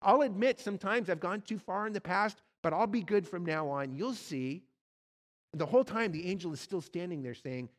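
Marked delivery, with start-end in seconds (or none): 0.0-4.6 louder, higher, wheedling patter; the lizard begging for its life, fast and pushy; chattering lizard. Acted. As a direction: lizard pleading with the ghost, loud and higher. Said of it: "the lizard starts chattering".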